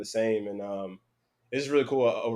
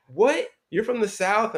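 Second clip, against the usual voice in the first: high, louder mock astonishment; disbelieving; 10 semitones higher, 5 dB louder, slower than usual. Locals hearing he was born in the South, astonished. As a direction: mimic stunned locals, pitch jumping up. Imitates locals doubting his origin.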